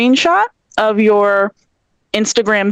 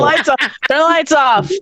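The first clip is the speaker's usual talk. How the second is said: nagging mom; 7 semitones higher than usual. Retold or imitated. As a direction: drag it out like a fussy mom. Mom voice nagging about lights.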